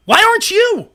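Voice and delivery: high-pitched exasperated outburst; sudden high, exasperated cry; indignant retort. Real, not acted. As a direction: fling the question back, indignant. Retort on being asked about yelling.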